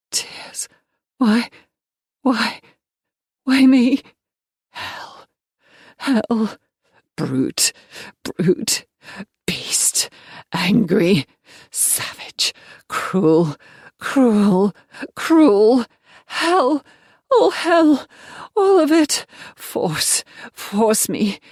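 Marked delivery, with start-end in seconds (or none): 1.2-4.0 loud, rising plea; anguished cry. Acted. Abandoned girl crying out why me to nobody. 12.9-19.3 louder and louder, climbing in pitch; building rage. Acted. Victim raging at her cruel abuser, building fury.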